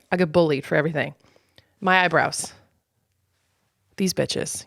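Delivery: usual speaking voice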